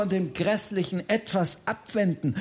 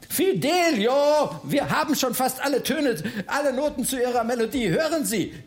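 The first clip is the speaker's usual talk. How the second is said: calling out; 6 semitones higher than usual. Acted. Bertha calls to the Freiherr about his melody, calling out. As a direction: call out to stop someone, pitched up. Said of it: "rief Bertha".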